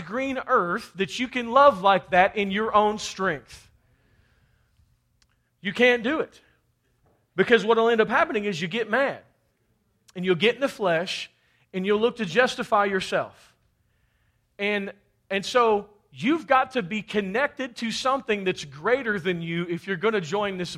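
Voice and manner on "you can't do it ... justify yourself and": ordinary delivery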